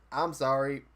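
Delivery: usual speaking voice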